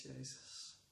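whispered prayer, barely breathed; fluisterend gebed. Real, not acted. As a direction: breathe his name in a hushed prayer. Persoonlijk gebed tot Jezus. (Jezus fluisterend aanroepen)

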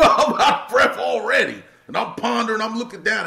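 loud, high, breathless-style retelling; worked-up reenactment. Retold or imitated. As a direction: act out being winded and pumped up. Reenacts being winded at action.

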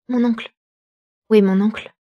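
calling out urgently for uncle; cri d'appel. A narrator's reading. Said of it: "s'écria Elisabeth". (appel pressant, plus fort)

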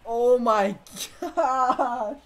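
slow, high, laugh-filled disbelief; laughing at himself. Real, not acted. Gamer laughing at his own choke, slow and giggling. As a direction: laugh through the words at your own choke.

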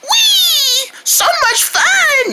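high, gleeful whoop; excited squeal. Acted. Character playing with friends, high excited squeal. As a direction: squeal with glee, drawing it out.

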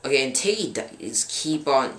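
ordinary talk

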